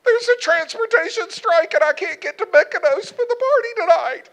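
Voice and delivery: high, loud, hammed-up wail of a stranded niece; mock sobbing wail. Niece crying to uncle about the ferry strike, hammed-up high wail. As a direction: whine it out like a teen stuck abroad. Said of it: "she was sobbing".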